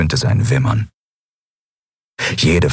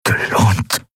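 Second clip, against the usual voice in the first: slow, breath-starved whisper; barely getting words out; slower than usual. A narrator's reading. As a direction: push the question out before a coughing fit. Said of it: "konnte das letzte Wort noch herausbringen".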